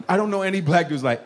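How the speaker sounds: ordinary talk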